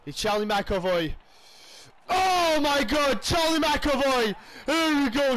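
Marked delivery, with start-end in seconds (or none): 2.1-5.4 excited. Real, not acted. Big hit in the game.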